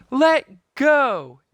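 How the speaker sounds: very high, loud shout; girl shouting at the pirate holding her. A narrator's reading. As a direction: yell it right into his face. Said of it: "Annie shouted into his face".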